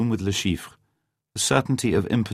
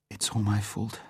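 much softer, teary self-blame; tearful; 3 semitones higher, 8 dB quieter than usual. A narrator's reading. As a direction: say it on the edge of crying. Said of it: "her eyes filled with tears".

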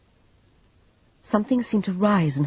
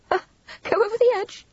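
short scoff, then high incredulous question; high-pitched derision; short laugh. A narrator's reading. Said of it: "Vivian gave a short laugh".

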